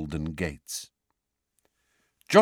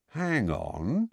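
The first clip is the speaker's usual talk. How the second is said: slowly; 6 semitones higher, 4 dB quieter than usual. A narrator's reading. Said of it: "said the wizard slowly".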